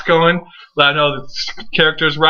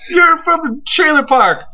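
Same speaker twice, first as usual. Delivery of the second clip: very high, drawn-out mock insult; taunting Diva; 13 semitones higher than usual. Fan mimicking one Diva insulting another, high-pitched taunt. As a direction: imitate her jeer, slow and high.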